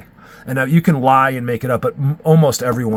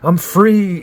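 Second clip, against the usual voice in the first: higher, louder cry of relief; relieved bargoer; 7 semitones higher, 5 dB louder than usual. Retold or imitated. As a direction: let it all out like Friday night after work. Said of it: "They're like".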